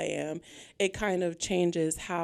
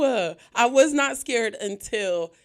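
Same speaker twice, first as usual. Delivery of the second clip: ordinary delivery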